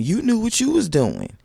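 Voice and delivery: high, loud accusation; calling out. Real, not acted. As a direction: call her out, pitch up. Calling out the cast member.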